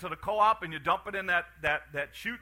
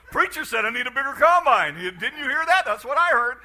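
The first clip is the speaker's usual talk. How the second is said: higher and louder, with laughter breaking through; teasing the congregation through a laugh; mock congregant, laughing; 5 semitones higher, 8 dB louder than usual. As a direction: play the eager farmer who misheard, chuckling. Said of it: "You're going".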